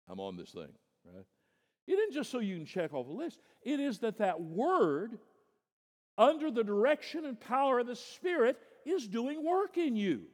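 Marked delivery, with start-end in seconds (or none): 1.9-10.3 much higher and louder, ringing preaching; full-force preaching. Acted. Preacher exhorting his congregation about the Word, at full force. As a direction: thunder it out to the whole hall.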